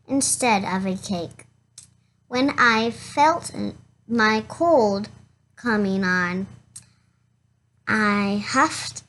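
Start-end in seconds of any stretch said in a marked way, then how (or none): none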